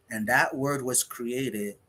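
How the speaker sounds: ordinary talk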